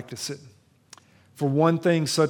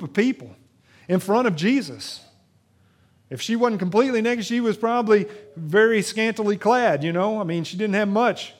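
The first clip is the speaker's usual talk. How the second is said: raised, driving preacher's voice, higher and louder; fiery preaching; 6 semitones higher, 6 dB louder than usual. Acted. Pastor to his congregation, retelling the adultery story, preaching at full force. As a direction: preach it out to the back pews.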